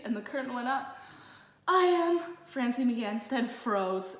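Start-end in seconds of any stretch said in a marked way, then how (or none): 1.7-2.4 stage opening. A narrator's reading. Francine begins on stage then freezes.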